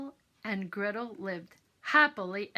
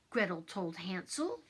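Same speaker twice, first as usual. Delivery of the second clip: ordinary delivery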